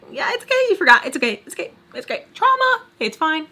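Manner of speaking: jumpy high inner voice; squeaky mock self-soothing; brain talking to itself. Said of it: "took my brain a little bit longer".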